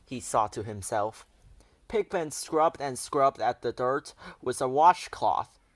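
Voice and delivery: plain reading voice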